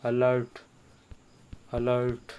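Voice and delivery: "alert" begun with a short अ (uh); teacher modelling "alert" with initial uh; opening अ sound. Shown as a demonstration. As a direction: say "alert" so its first sound is a clear अ. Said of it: "ध्वनि अ की निकल रही".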